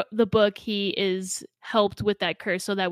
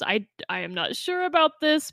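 mildly nervous, pitched-up quoted voice; 7 semitones higher than usual. Retold or imitated. Retelling what the book's heroine said, mildly nervous. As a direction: voice a hesitant heroine with a slight stammer. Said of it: "the character was nervous".

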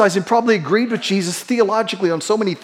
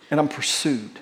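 hushed; 7 semitones lower, 9 dB quieter than usual. Real, not acted. Sermon climax, dropped voice.

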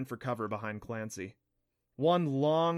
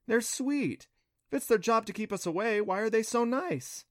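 high-pitched, innocent character voice; high voice of a softhearted heroine; puzzled Clancy; 9 semitones higher than usual. A narrator's reading. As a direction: lift it high, puzzled and fond. Narrator voices Clancy, puzzled and fond.

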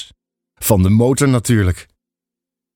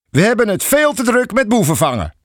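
a higher, lightly voiced scolding policeman; stern officer; 10 semitones higher than usual. A narrator's reading. Police officer scolding the father, stern. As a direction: brush him off, self-important. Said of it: "spreekt de agent mijn vader streng toe".

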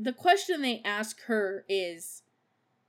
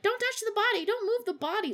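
higher, bossy orders; bossy Gladys; 12 semitones higher, faster than usual. Retold or imitated. Imitating Gladys ordering people off Janelle, higher. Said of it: "Gladys keeps being like".

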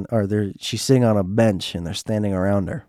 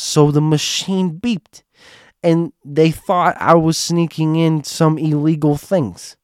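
higher, slow, drawn-out indignation; Joanne; 6 semitones higher, slower than usual. Retold or imitated. Joanne recounts the security check, mock indignant. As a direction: stretch the words in a higher put-on voice. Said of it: "this is my impression of her".

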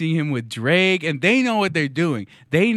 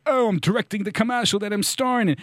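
lightly voiced self-important ad guy, higher; mocked commercial actor; 5 semitones higher, faster than usual. Comedian mimics the Geico guy in a meta ad, lightly mocking. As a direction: put on an obnoxious actor a little. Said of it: "The Geico guy, he comes in, he's like".

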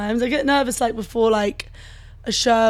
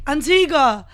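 mock call-out of a mangled name; imitated shout; 5 semitones higher, 6 dB louder, slower than usual. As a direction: hail someone across the street, half-shouted, higher and louder. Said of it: "I shouted Antigua".

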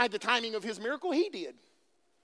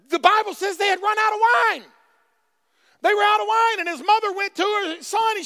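raised, emphatic preacher delivery; loud, rising sermon fervor; full-force preaching; 4 semitones higher, 5 dB louder than usual. Acted. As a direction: build it up loudly for the congregation.

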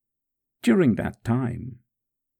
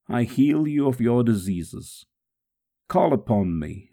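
plain reading voice

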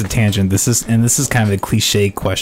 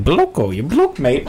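joking mock-English accent, a bit higher; 4 semitones higher, slower than usual. Host joking on guest's name in a mock English accent. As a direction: put on a jokey English accent. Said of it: "like English, you know?".

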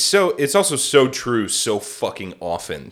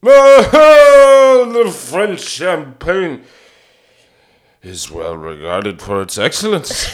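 huge wailed Ah, then slow slurred grandeur; drunk Orson Welles; 4 semitones higher, 9 dB louder, slower than usual. Retold or imitated. Drunk Orson reading a champagne commercial, booming and slow. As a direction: bellow the opening, then drag out the words. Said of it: "he's completely ripped".

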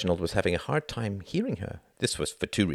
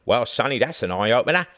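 put-on Cockney accent; 6 semitones higher, 5 dB louder than usual. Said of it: "imitating a Cockney accent".

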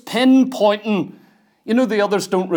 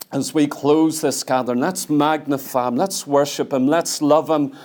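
worship prayer; 4 semitones lower than usual. Acted. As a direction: gently lead the congregation into worship. Leads closing worship prayer.

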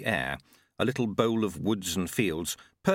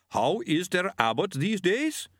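higher, slow, distant Yeti voice; bigfoot grunting; 8 semitones higher than usual. A narrator's reading. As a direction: speak slowly like a gentle big Yeti. Yeti speaks in story.

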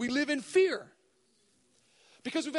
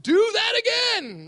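loud, much higher, slowed whoop of delight; thrilled cheer; 9 semitones higher, 12 dB louder, much slower than usual. Acted. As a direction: cheer like a brain getting a treat. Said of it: "the amygdala goes".

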